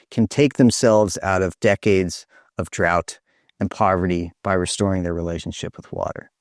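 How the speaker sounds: ordinary talk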